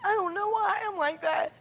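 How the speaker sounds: high, tearful crying voice; talking through tears. Real, not acted. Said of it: "Talk through the tears".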